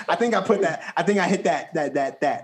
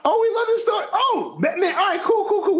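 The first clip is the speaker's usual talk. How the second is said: excited shout; faster than usual. Real, not acted. Excited by guests' stories.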